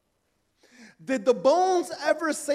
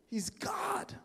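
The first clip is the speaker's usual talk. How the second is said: whisper; 7 semitones higher, 17 dB quieter than usual. Real, not acted. Closing hushed declaration.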